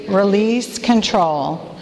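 slow, deliberate call-and-response line; slow group recitation. Shown as a demonstration. Leader saying it together with the women, slow and deliberate. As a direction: say it slowly with the room. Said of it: "Say that with me".

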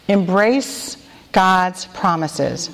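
slow, weighty reading of the letter's phrase; slow recited phrase. Shown as a demonstration. Leader introducing the acrostic letter to the women, slow and deliberate. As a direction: lay each word down slowly for the room to echo. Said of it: "Say that with me".

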